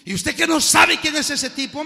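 high, loud mocking question; mock him for not knowing; scoffing young man. Young man scoffs at the preacher, loud and high. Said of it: "me dijo".

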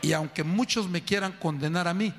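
normal talking voice

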